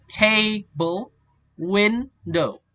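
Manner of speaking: "table", "window" slow, split, first syllable stretched; initial stress. Shown as a demonstration. Teacher giving examples of initial stress, "table" and "window" stressed up front. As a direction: lean hard on TA and WIN. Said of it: "stress on the first syllable".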